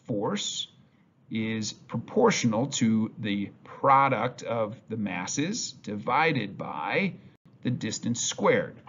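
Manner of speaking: slowly, word by word, spelling out the formula; slow formula dictation. Shown as a demonstration. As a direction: dictate the formula slowly so students can write it down. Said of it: "This statement can be expressed as".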